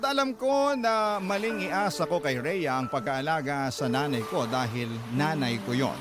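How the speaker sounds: ordinary talk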